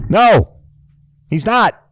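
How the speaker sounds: ordinary talk, nothing marked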